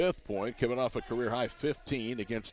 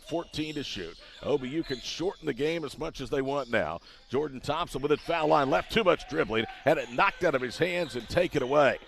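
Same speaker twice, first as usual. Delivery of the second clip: raised, driving live call; excited play-by-play; 3 semitones higher than usual. Real, not acted.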